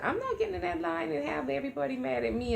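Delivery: high, quick animated objection; retold protest. Friend refusing to cut into the line, protesting. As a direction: imitate a friend protesting in a rush.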